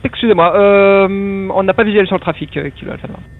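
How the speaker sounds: loud, high radio call; urgent pilot voice through the radio; pilot over the radio. Real, not acted. Pilot answers traffic information by radio.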